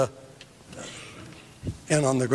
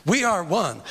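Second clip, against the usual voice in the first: ordinary talk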